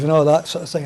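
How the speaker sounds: ordinary delivery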